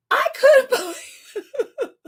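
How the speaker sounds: can't believe it, laughing it out; laughing in disbelief. Real, not acted. Recounting a stranger's outrageous act.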